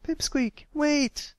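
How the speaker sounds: calling out. A narrator's reading. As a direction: call him back as he runs. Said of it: "Applebloom called out".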